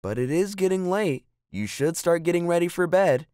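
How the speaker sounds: high, insistent motherly reminder; interrupting mother. A narrator's reading. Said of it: "Mother walked into the room and interrupted".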